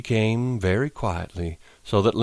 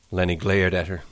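ordinary delivery, nothing marked

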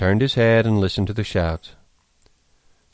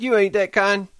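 high, raised voice; crying out; 11 semitones higher, 5 dB louder than usual. A narrator's reading. Lenny trusting his friend George, high loud cry. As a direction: exclaim it with childlike trust. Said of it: "Lenny cried".

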